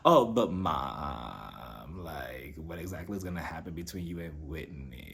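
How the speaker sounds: imitating Brooks. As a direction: drop way down and murmur like the teen son. Son Brooks voiced in recap.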